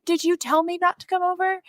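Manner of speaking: high, puzzled father-in-law voice; innocent father-in-law. Retold or imitated. Father-in-law at Sunday dinner, puzzled and innocent. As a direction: play him all innocent, a touch hammed-up.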